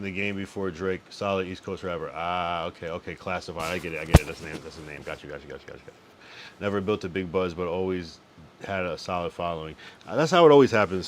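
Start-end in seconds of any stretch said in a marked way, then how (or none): none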